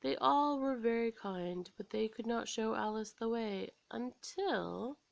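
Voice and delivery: ordinary delivery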